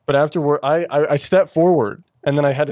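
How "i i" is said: ordinary talk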